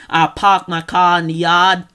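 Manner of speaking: loud Boston accent, dropped R's. Shown as a demonstration. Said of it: "mean Massachusetts stuff".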